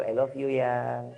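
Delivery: slow, soft loving line; wife lovingly tells her husband goodnight, slow and soft; tender bedtime words. Retold or imitated. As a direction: murmur it gently to him at bedtime.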